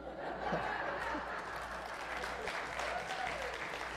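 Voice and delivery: a big airy laugh at his own joke; real laugh at his own line; high breathy laugh. Real, not acted.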